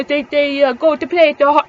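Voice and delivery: imitating a Gatineau French accent, mouth full as if with a potato; mock a Gatineau French accent with the tongue stuck to the roof. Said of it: "They talk like this".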